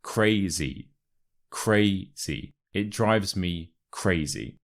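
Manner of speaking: "crazy" as CRAY-zee; stress on first syllable. Shown as a demonstration. Teacher drills pronunciation for learners, "crazy" stressed on "cray". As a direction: lean on a long "cray" in "crazy", light "zee". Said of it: "The stress is on the first syllable".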